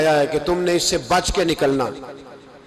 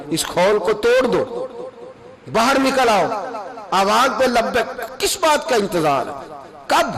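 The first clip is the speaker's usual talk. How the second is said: fervent call; 5 semitones higher than usual. Acted. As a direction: urge them out of their shell. Exhorting to break free. (دھیمی مگر بلند پکار)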